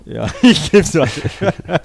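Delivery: high, slow laughing echo. Real, not acted. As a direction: repeat it through laughter. Repeats partner's line, amused. (lachend nachgesprochen)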